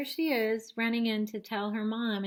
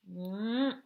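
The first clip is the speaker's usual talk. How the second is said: ordinary delivery, nothing marked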